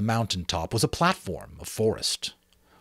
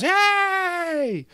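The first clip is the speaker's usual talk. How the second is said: high, bright crowd cheer; 3 dB louder than usual. Acted. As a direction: whoop with delight. Assembly cheers and claps.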